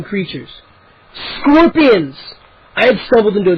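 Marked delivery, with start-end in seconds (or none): 1.4-2.2 horrified exclamation. A narrator's reading. Sees the scorpion nest.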